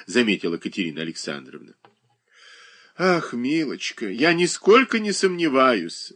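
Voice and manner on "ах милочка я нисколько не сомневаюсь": fervent. A narrator's reading. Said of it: "горячо ответила Шершнёва".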